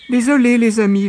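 high, loud rabbit figure; mocking rabbit. A narrator's reading. Rabbit hailing his duped friends by the pond, mocking. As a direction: call it out high with sly mockery. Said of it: "l'air narquois".

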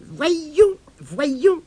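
ordinary delivery, nothing marked

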